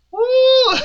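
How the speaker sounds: high "woo" with a laugh after; excited whoop. Real, not acted. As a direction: let out a high, drawn-out woo. Excited cheer over the show.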